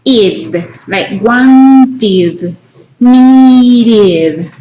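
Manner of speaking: "wanted" and "needed" with the ending said as a clear extra "id" syllable. Shown as a demonstration. Teacher showing students the id ending, clearly. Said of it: "vamos a utilizar".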